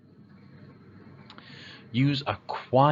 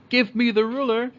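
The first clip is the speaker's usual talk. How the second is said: high, loud bossy demand; rude demand; 12 semitones higher, 6 dB louder than usual. Shown as a demonstration. Kid rudely demanding the ruler, high loud bossy tone. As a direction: bark it at the teacher like an order. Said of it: "Instead of saying".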